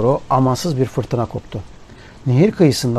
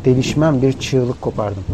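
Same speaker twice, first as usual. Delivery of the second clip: ordinary delivery, nothing marked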